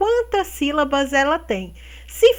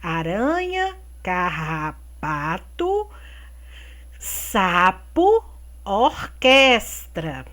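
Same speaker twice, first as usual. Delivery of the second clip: read aloud slowly; 5 semitones lower, 4 dB quieter, slower than usual. Shown as a demonstration. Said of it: "Fale as palavras e a seguir em voz alta".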